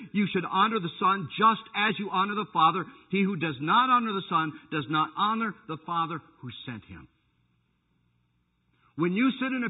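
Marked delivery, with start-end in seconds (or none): 0.1-7.1 loud ringing scripture quote; preaching at full force. Acted. Preacher booming scripture to the congregation. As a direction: proclaim the verse loudly to the church.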